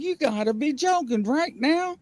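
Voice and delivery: high-pitched retold disbelief; retold flipping out. Storyteller quotes own reaction at the cove, high disbelief. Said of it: "I was flipping out".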